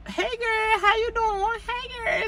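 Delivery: syrupy high imitation of Marlo; fake-friendly high voice. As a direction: coo it like a fake friend calling up. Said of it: "It's like that Friday mother".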